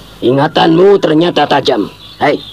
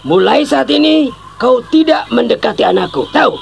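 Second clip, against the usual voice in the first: "Mulai saat ini" thrown out much higher and harder; furious father repeating "Mulai saat ini" far higher; angry command; 9 semitones higher than usual. Acted. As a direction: spell out the ban with rising anger. Father repeats the ban angrily.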